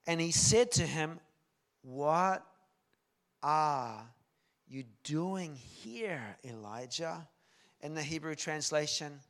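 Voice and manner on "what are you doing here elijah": word by word, long pauses; spaced-out, weighty question; deliberate first question. Acted. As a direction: ask it slowly at normal volume, easing off at the end. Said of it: "This time it's a quieter voice".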